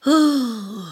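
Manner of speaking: long, high sigh. A narrator's reading. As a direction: sigh with regret.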